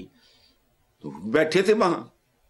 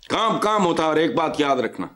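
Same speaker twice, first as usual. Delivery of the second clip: ordinary talk, nothing marked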